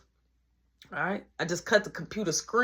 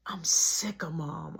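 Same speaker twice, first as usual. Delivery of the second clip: whisper; 4 semitones lower, 4 dB quieter, slower than usual. Retold or imitated. Brother whispering complaint.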